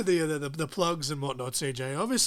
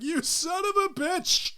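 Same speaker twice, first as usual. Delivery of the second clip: outraged cry; 13 semitones higher, slower than usual. Retold or imitated. Retold outburst at game twist.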